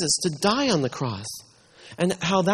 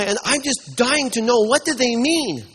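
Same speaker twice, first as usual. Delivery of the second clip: ordinary talk, nothing marked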